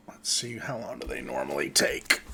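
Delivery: low whispered question to himself; whispered aside. Real, not acted. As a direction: mutter it under your breath. GM muttering to himself.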